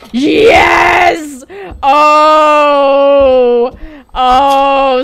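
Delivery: loud triumphant yell; victory shout. Real, not acted. Gamer cheering a win with a loud triumphant yell.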